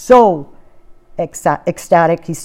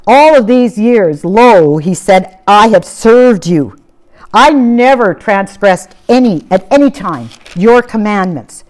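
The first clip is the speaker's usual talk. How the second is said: loud, slow, resentful protest to his father; aggrieved complaint; 3 dB louder than usual. Retold or imitated. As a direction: lay out every grievance, loud and slow, then fade. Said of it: "his complaint to his father".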